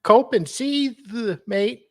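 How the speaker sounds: very high-pitched fake Aussie; Australian accent. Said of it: "Oh, God, that's, no".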